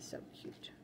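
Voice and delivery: soft whispered aside. Real, not acted. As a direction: breathe it out almost silently. Whispered aside over cat sticker.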